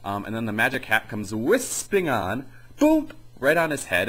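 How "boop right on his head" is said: ordinary talk, nothing marked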